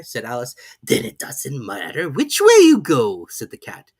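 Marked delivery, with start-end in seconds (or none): 0.8-3.3 slow, drawling cat voice; Cheshire Cat. A narrator's reading. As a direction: toy with the girl lazily.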